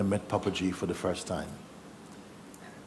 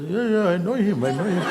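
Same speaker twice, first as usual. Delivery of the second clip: higher, keen voice with a laugh; eager driver; 8 semitones higher, 4 dB louder than usual. Retold or imitated. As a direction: chime in eagerly as if vouching for him.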